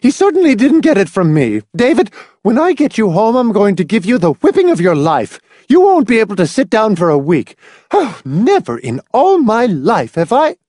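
high, loud maternal tirade; rushing on angrily. A narrator's reading. Mother threatening son with punishment, high loud anger. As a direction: scold without letting up. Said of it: "Missus Pritchett rushed on".

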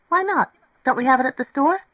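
high, loud, excited pleading; eager insistence. Acted. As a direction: press him eagerly, pitched well up. Mary Ann insists on playing it.

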